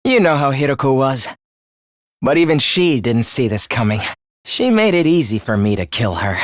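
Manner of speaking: low-pitched, chilling murder confession; dark, low gloating; cold gloating. Acted. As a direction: recount the kill in a low, cold voice. Killer gloats over the murder.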